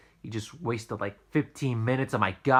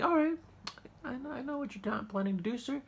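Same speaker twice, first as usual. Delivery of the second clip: much higher, sugary mock-polite customer service voice; mock-polite retail voice, pitched way up; fake-polite clerk; 3 dB quieter than usual. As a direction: sweeten it up high and fake like a clerk humoring a jerk.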